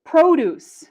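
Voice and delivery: produce as PRO-duce, high loud start; first-syllable stress. Shown as a demonstration. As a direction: hit the PRO of produce and drop away. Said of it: "stress the first syllable".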